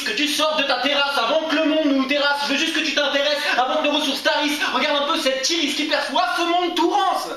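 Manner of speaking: high-pitched, driving accusation in verse; impassioned slam tirade. Acted. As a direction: hammer the rhymes at the one accused. Poem rises into accusing tirade.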